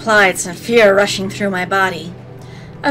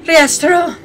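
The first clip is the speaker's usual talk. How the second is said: calling out; 8 semitones higher, 3 dB louder than usual. Acted. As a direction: shout the name. Calling out true name.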